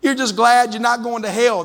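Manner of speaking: ordinary talk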